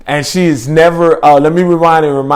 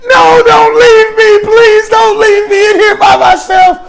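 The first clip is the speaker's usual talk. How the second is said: loud, very high mock wail; drawn-out hammed-up sobbing beg; crying plea; 7 dB louder, slower than usual. Girl imitated begging not to be left alone, crying. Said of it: "Starts crying immediately again".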